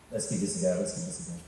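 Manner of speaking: low, soft talk to himself; self psych-up. Retold or imitated. Preacher recalls himself facing the wall, low self psych-up. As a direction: quietly talk yourself into it, voice dropped low.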